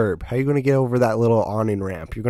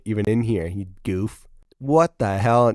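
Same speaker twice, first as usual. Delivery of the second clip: normal talking voice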